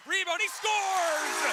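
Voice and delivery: loud rising goal call; goal call shout. Real, not acted. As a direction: yell the goal with excitement. Goal call in hockey broadcast.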